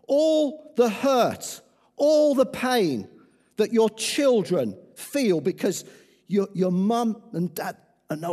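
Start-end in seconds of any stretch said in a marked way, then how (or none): 0.1-3.1 "All the hurt, all the pain" slow and loud, ALL hit high; slow heavy stress. Acted. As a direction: lean hard on "All" each time. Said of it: "repeated with slow weight".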